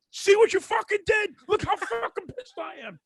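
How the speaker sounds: high, slow, strained mock rage; hammed-up high-pitched fury; aggravated. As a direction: show off how pissed you are, stretching the words. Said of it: "so fucking aggravated".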